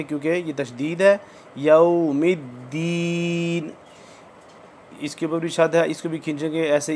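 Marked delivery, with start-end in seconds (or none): 1.6-3.7 यौमिद्दीन slow with a held doubled द; drawn-out recitation. Shown as a demonstration. Teacher models यौमिद्दीन for students, drawn out. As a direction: hold the द in यौमिद्दीन. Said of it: "इसको ज़रा खींच के".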